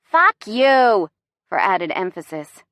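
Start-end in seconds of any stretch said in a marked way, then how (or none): 0.0-1.1 hammed-up Boston accent, shouted; loud acted Boston yell; loud Bostonian shout. A narrator's reading. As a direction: holler it like an irate Bostonian. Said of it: "shouting a loud Bostonian".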